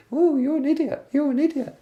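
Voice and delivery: very high falsetto cartoon mockery; silly squeaky voice. Shown as a demonstration. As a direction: squeak the insult so it sounds ridiculous. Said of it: "inside her head she'd just repeat".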